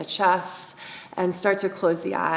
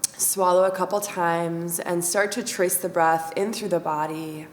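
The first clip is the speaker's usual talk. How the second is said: guided meditation; 5 dB quieter than usual. Acted. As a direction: guide the breath in and out, calm and unhurried. Leads breath meditation.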